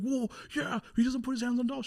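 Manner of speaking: high-pitched, loud mock outrage; indignant self-defence. Man answering mom's accusation, indignant yell.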